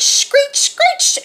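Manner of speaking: very high, piercing screech on the word; screeching owl. A narrator's reading. Narrator voices Owl waking everyone, high screeches. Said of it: "Owl screeched".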